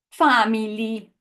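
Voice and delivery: "family" in mock Italian, FAH-mee-lee, all vowels full; mock Italian accent. Shown as a demonstration. Teacher shows what we don't say, "family" in mock Italian accent. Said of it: "We don't say".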